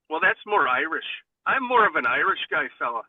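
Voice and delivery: ordinary talk, nothing marked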